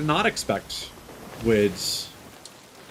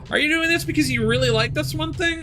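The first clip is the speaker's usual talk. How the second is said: higher, pointed challenge to a creator; pointed rhetorical question; 11 semitones higher, faster than usual. Pretend. Vlogger challenging single-game creators, pointed and higher. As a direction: ask it like you're calling someone out.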